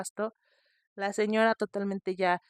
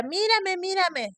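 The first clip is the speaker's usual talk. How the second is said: put-on high, eager little boy's voice; a small child pleading to be looked at; little boy begging; 10 semitones higher, 5 dB louder, slower than usual. Little boy begging his family to look at him. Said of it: "y el niño decía".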